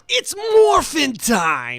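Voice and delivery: high, slow and loud catchphrase; hyped catchphrase. Acted. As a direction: call it out like a show opener. Power Rangers catchphrase.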